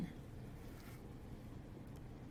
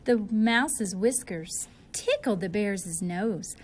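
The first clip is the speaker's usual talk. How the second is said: usual speaking voice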